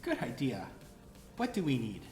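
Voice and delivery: normal talking voice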